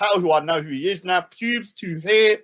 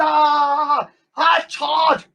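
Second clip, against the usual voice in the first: high hammed-up yell; mock battle cry; 7 semitones higher, 4 dB louder than usual. Mocking opponents, yelling their charge.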